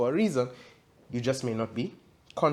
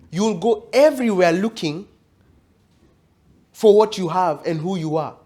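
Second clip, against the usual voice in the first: raised, emphatic sermon voice building in intensity; fervent preaching; 5 semitones higher, 5 dB louder than usual. Acted. Preacher urging the congregation about the ultimate deception, fervent preaching. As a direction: drive it home to the congregation.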